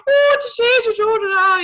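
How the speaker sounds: high, exaggerated mock-Irish accent. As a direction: tease with a hammed-up Irish accent. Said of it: "we don't sound like that".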